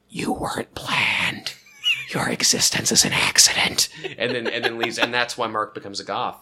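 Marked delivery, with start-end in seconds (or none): 0.1-3.9 slow creepy whisper. Retold or imitated. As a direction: breathe it into his ear. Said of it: "he whispers into Mark's ear".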